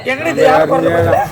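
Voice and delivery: normal talking voice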